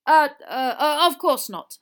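loud, stammered backtracking; flustered mumble. A narrator's reading. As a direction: stumble into a hasty retraction. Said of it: "mumbled Mister Diggory".

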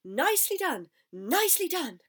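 loud, high cheering praise; cried out. A narrator's reading. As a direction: cheer them on like an excited goose. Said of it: "cried the goose".

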